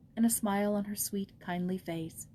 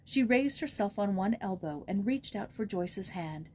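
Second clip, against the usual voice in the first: ordinary delivery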